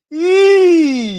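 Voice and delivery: high, loud cackling laugh. Real, not acted. Fan laughing at the opponent's own goal, howling. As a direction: crack up at the blunder.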